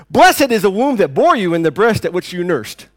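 loud, high exclamation from the crowd; crying out. Retold or imitated. Woman in the crowd crying out to Jesus, loud. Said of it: "cried out and raised her voice".

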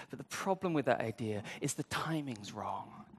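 whispered aside; confiding whisper. Real, not acted. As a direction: drop to a hush for the point. Pointed aside on false teaching.

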